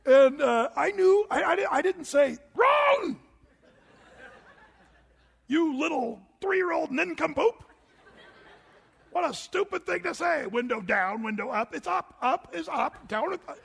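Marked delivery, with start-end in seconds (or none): 2.5-13.6 higher, louder hammed-up scolding through laughter; mock scolding father. Pastor mocks a harsh father scolding his toddler, laughing scolding. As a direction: play a ridiculous harsh dad berating a toddler.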